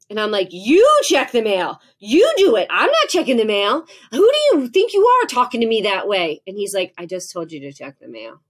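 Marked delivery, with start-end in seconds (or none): 0.5-6.4 loud, high, indignant comeback; yelling back. Retold or imitated. Mother yelling back at her son over the mail, loud and indignant. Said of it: "I cuss and yell at him". 7.0-8.4 soft, low understatement; quiet retort. Retold or imitated. Son answering his yelling mother, quiet and low.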